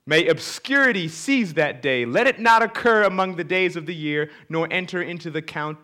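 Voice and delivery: loud, high declaiming read; scripture reading. Acted. Preacher reads Job's curse to the congregation, loud declamation. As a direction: curse the day as Job would.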